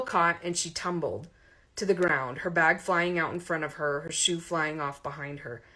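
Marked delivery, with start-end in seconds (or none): none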